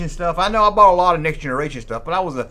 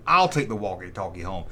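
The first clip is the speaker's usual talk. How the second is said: second kid's reply, voiced lower; other kid answering; 8 semitones lower, 4 dB quieter than usual. Kids sharing walkie-talkies.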